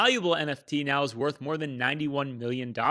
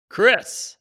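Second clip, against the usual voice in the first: high, loud call of a name; 8 semitones higher, 8 dB louder than usual. Real, not acted. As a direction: call his name across the room. Host calls on co-host.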